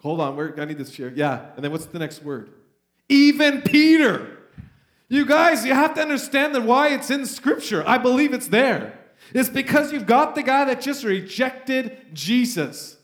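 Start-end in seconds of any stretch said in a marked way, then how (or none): 3.1-13.0 higher and louder, slowing on Even Peter; preaching at full force. Real, not acted. Preacher stressing Peter's name to the church, fervent and loud. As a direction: drive home that Peter too is forgiven.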